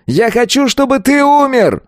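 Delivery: very high, vicious yell. A narrator's reading. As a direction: scream a death sentence. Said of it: "заорал он". (яростно заорать высоким голосом)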